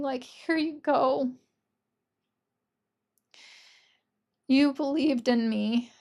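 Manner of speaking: choked-up, slow, fighting real tears; moved almost to tears, slowing down; holding back tears. Real, not acted. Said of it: "for crying a little bit".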